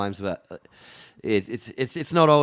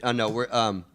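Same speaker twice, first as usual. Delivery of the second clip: ordinary delivery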